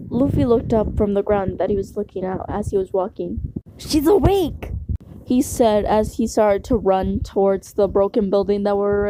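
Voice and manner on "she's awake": excited. A narrator's reading. Luffy excited to see listener.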